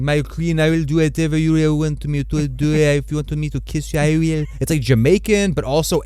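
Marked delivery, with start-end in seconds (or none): 0.0-4.4 put-on Mysaria accent. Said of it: "it's hard to even do her accent".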